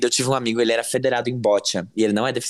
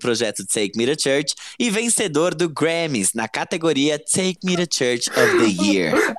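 slow, higher mock news read; news headline read; 4 semitones higher, slower than usual. Reads fake headline.

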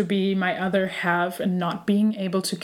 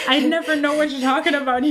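laughing through the words, higher; giggling embarrassed reply; 7 semitones higher, 3 dB louder, faster than usual. Real, not acted. Laughing at friend's awkward question.

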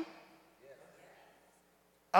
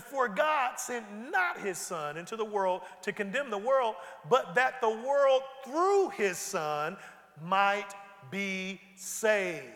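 slow scripture reading; slower than usual. Real, not acted. Rereads verse slowly.

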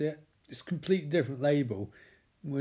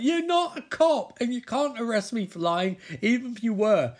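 indignant squeaky woman; 8 semitones higher, 5 dB louder than usual. Retold or imitated. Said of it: "She's like".

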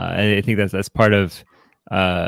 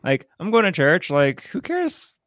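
higher, careless mock brush-off; flippant excuse; 11 semitones higher than usual. Hypothetical churchgoer excusing themselves, flippant. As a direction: shrug it off in a raised pitch, dragging the end.

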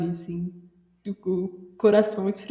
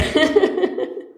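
bright laugh that jumps up high; short real laugh rising high; high burst of laughter; 10 semitones higher, 4 dB louder than usual. Real, not acted. Laughs after own quote.

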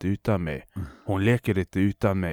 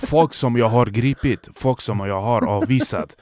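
usual speaking voice

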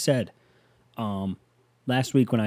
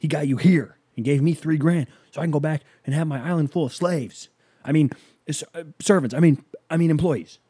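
lightly voiced slick guru, higher; Tony Robbins; 5 semitones higher, 4 dB louder than usual. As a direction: pitch it like a smug seminar speaker. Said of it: "he's like".

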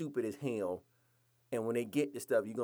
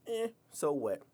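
ordinary talk, nothing marked

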